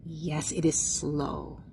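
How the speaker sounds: low, slow and stretched; drawn-out slow. Shown as a demonstration. Teacher answering whether walking speed is slow, low and drawn-out. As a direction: drag the words out slowly.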